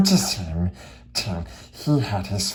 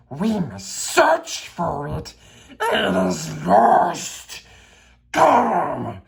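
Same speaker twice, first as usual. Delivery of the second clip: higher, slow, gulping creature voice; Gollum fretting; 6 semitones higher, slower than usual. Acted. Gollum insists on searching, slow and desperate. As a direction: fret and gulp like a desperate creature.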